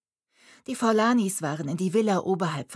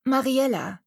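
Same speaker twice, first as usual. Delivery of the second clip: put-on girl's voice; 7 semitones higher, 4 dB louder than usual. A narrator's reading. Mariella's answer, voiced.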